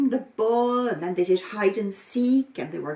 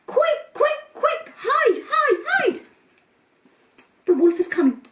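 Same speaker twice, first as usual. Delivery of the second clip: very high, loud frantic warning; panicked shout; 8 dB louder than usual. Acted. Goat kid spots the wolf and shouts to the others in panic. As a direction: yell to hide in terror. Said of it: "he shouted".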